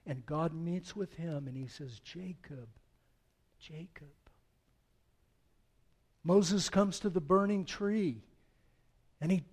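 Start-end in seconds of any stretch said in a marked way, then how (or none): 2.0-4.1 hushed, softer calling of the name; tender call. Acted. God gently calling Jacob by name, soft and tender. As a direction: speak his name gently, like a loving father. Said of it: "so filled with love and tenderness".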